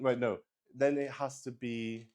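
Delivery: normal talking voice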